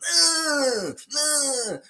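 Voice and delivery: high wailing mock sob. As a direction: ham up a high, dramatic cry. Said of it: "Would he be like [name]?".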